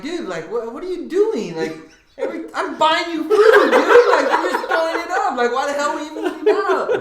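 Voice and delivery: high, loud, laughing exasperation; scolding his girlfriend for throwing up the food, high and laughing; exasperated rant. Retold or imitated. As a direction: scold her while half laughing.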